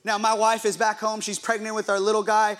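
ordinary talk

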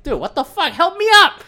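sudden high, loud outburst; exasperated yell. Retold or imitated. The fallen son yelling at his condescending dad, high and loud. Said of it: "I'll be like".

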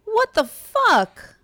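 high, slow disbelieving outburst; shocked. Real, not acted. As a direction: blurt it out in disbelief. Shocked at Dirty Dancing news.

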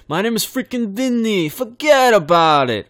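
high, slow, hammed-up New York wiseguy; overdone New York accent. Shown as a demonstration. Host overdoing the New York accent, then asking if it's too much. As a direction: overplay the Brooklyn tough guy. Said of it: "was that a little too much?".

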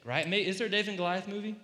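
ordinary delivery

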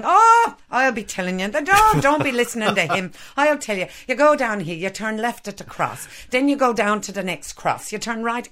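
an eager Irish local talking over the others; Irish pub regular giving road directions in a broad Irish accent. Retold or imitated. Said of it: "my hideous accent".

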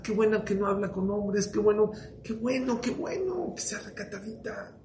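high, sing-song mocking husband; lightly hammed-up proud husband. Mocking husband praising modest wife.

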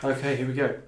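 ordinary talk

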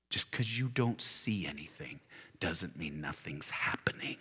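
hushed unvoiced whisper. Real, not acted. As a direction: lean in and whisper the encouragement. Whispered exhortation.

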